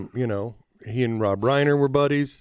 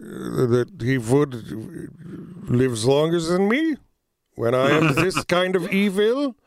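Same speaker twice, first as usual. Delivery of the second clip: put-on halting foreign-accented villain griping about unfairness; Kissinger; 3 semitones higher than usual. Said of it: "there you go, Henry".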